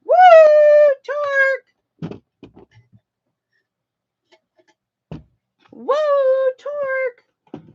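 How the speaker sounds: sustained high-pitched hoot; mock excited cheer. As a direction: whoop it like a gleeful sound effect. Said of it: "You think that it would be like".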